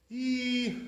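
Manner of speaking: long, high-pitched EE sound; E held out as a high, drawn-out ee; high E vowel. Shown as a demonstration. Teacher demonstrating chirik to students, high long E. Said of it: "not so high like that".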